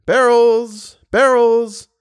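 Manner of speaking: high, loud hawker's call; street vendor's cry. Acted. Barrel seller calling out to passersby, loud. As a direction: call out your wares down the street.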